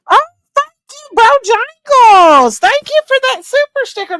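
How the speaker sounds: gushing thanks. Real, not acted. Thanking a viewer for a paid sticker, high squealing gratitude.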